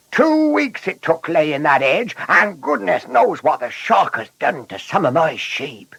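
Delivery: high, indignant farmer's rant; roaring shepherd. A narrator's reading. Shepherd upset about his hedge and sheep, roaring. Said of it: "roared the shepherd".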